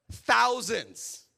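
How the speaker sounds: loud, high burst of shock on thousands; stunned disciples. Retold or imitated. Disciples stunned at feeding the crowd, loud and high. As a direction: blurt it out in disbelief. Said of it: "the disciples are like".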